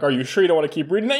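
quick, eager mock question; rushed mock question. Surgeon hurrying the radiologist to finish reading, rushed. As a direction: hurry it out, pushing them on. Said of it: "I'm like".

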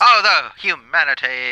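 hammed-up 1940s radio lament; old newsreel narrator. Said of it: "nineteen forties radio voice".

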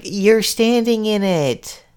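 high, slow, drawn-out imitation; hammed-up high-pitched reply; mock retort. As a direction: stretch it out high, imitating her. Acting out Joan's retort.

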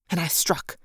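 "and I struck" muted, almost under the breath; quiet. A narrator's reading. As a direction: say "and I struck" softly and drop away. Battle trance, first strike.

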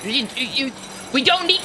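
high, rapid agitated objection; flustered protest. Acted. Flustered guard protests over the noise.

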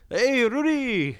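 high, loud, slow drawn-out holler; calling out. Retold or imitated. Horticulture people hailing the wrong man, loud stretched call. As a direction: hail someone loudly from afar, stretching the name.

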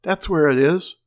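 loud frantic insistence; wife yelling to her husband, frantic; screaming. Acted. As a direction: keep yelling to convince him. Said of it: "she screamed".

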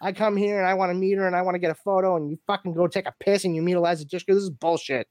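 fast, indignant mock tirade; imitating an aggrieved friend; jealous rant. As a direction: rattle off an outraged complaint. Jealous friend's angry rant retold.

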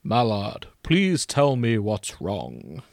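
slow, gentle wizard-like plea; unhurried, tender and grave; kindly. Acted. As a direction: ask with patient brotherly concern. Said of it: "asked him kindly".

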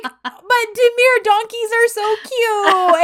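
high, loud, drawn-out wail; hammed-up drunken gushing, close to crying; tearful mock whine. Drunk woman defends donkeys to him, tearful mock whine.

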